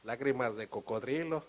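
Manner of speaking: usual speaking voice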